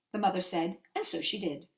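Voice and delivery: usual speaking voice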